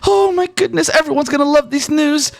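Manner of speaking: very high, gushing fan of the hero; thrilled reporter. Acted. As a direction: squeal with joy that she is alive. Reporter gushes over Caliph.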